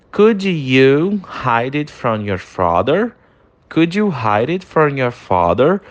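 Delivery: slow, careful question with a rising end; slow English model sentence. Shown as a demonstration. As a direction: read slowly for repetition. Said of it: "segunda frase de exemplo". (frase em inglês lenta, final subindo)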